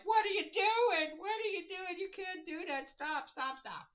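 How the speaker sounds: high, loud frantic shouting; mock-frantic hero yelling stop; Superman, alarmed. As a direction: panic at him to stop. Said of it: "And Superman's like".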